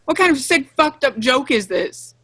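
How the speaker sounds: loud, high furious outburst; angry. Acted. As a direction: lash out in fury. Lurches at Eve angrily.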